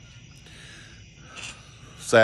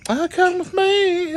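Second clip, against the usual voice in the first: put-on high, slow coaxing girl voice; stripper's invitation; slower than usual. Dancer inviting the teller to a back room, high slow coax. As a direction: lure him in with a high sing-song.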